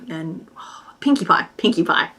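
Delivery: ordinary talk, nothing marked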